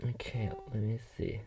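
quick whispered self-talk; whispering while looking. Real, not acted. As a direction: murmur it unvoiced to yourself. Whispers while searching online.

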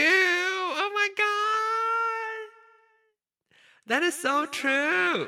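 high, slow, echoing shout; excited cry through an echo effect; digital echo. Real, not acted. Excited reaction with mixer echo.

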